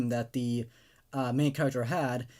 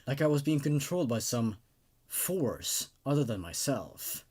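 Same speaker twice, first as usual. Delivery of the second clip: low, measured storyteller's voice reading a horror tale; creepypasta narration; 5 semitones lower, 4 dB quieter than usual. A narrator's reading. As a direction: read it darkly, keeping the listener uneasy.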